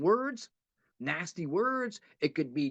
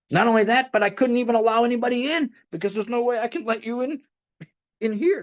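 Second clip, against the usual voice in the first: louder, urging the meeting on; preaching; 2 semitones lower, 8 dB louder than usual. Acted. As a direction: preach it to the room, insistent.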